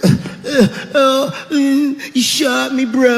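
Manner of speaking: lightly voiced whiny dying addict; mock dying addict. Dying addict moans last words to the shooter, mock whiny. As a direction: whimper it like a dopey dying junkie. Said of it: "saying stupid heroin last words".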